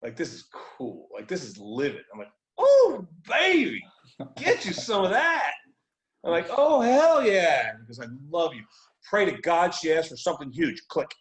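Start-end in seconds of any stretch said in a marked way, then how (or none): 2.6-7.7 much higher, louder, drawn-out cheer; hyped up. Retold or imitated. Man psyching himself up with a loud, slow cheer. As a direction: revel in it slowly, pumped.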